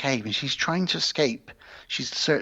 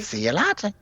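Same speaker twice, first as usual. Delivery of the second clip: movie one-liner; 8 semitones higher than usual. Acted. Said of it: "cool to see him say".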